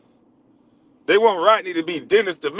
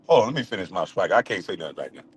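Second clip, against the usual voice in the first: ordinary delivery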